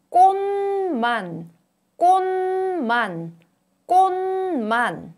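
꽃만 said as kkonman, nasal n; 꽃만 with ㅊ turned to n; exaggerated word endings. Shown as a demonstration. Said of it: "is pronounced as n, as in".

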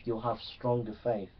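ordinary talk, nothing marked

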